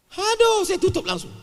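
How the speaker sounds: high-pitched, loud "aduh"; mock dismay. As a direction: cry out in comic exasperation. Mock despair at Vietnamese video. (pura-pura kewalahan, suara melengking tinggi)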